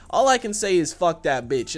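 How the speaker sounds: ordinary talk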